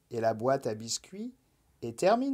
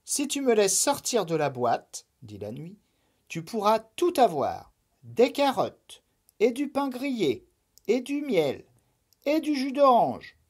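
higher, rising enticing list; lilting persuasive Night voice; coaxing Night; 7 semitones higher than usual. Acted. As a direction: tempt the rabbit with treats. The Night tempts Lapin.

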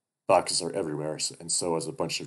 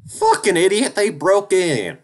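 furious shriek; 14 dB louder, slower than usual. Acted. Duke yells at the bear.

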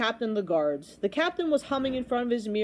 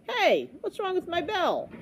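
higher, slow puzzled question; puzzled old man; 9 semitones higher than usual. A narrator's reading. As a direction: wonder aloud what broke. Bell ringer puzzled.

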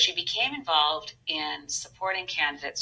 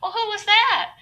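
put-on Southern accent; 7 dB louder than usual. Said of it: "I said".

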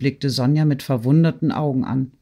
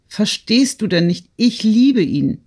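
high, insistent declaration of love; impassioned plea; 6 semitones higher, 4 dB louder than usual. A narrator's reading. Young woman confessing her love to her friend, impassioned. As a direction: plead higher, stretching out the last words.

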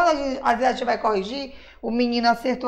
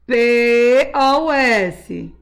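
spelled slowly; 4 dB louder, much slower than usual. Shown as a demonstration. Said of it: "Não se esquece da regrinha".

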